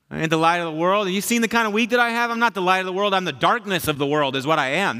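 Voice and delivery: high-pitched, worked-up mock rant; indignant protest. Young pastor arguing with Jesus after an awful week, indignant protest. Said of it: "tell God that he's wrong".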